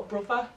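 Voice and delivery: "brother" said as bruvver, th turned into v. Shown as a demonstration. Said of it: "brother for brother".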